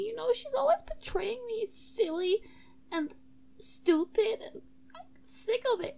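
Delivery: high, plaintive character voice; wronged emo student. Acted. Zara Hall admitting the snail is locked away, high wronged voice. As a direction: confess with hurt pride in a high voice.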